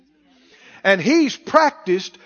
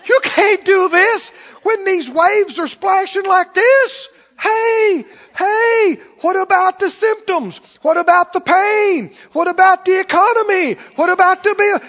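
high, pleading, hammed-up yells; desperate devil; 9 semitones higher, 5 dB louder than usual. Devil throwing worries at the believer, desperate. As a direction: pile up frantic objections to distract. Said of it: "you will hear the desperation in the devil's voice".

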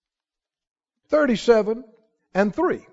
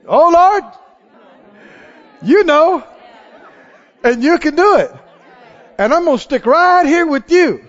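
raised, ringing declaration of trust; faith talker; 4 semitones higher than usual. Acted. Believer answering the Lord about a problem, confidently. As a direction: proclaim it with assured, lifted conviction. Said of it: "become a faith talker, faith responder".

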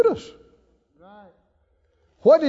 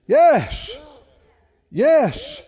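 usual speaking voice

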